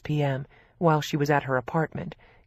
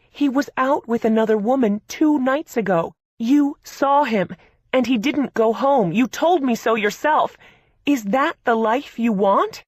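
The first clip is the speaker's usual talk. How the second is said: angry; 7 semitones higher, 7 dB louder than usual. A narrator's reading. Sister pleads furiously.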